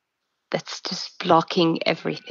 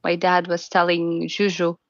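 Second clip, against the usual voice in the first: ordinary talk, nothing marked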